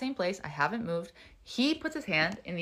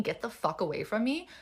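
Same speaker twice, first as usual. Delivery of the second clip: normal talking voice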